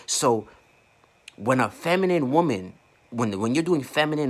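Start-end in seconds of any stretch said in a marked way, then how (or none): none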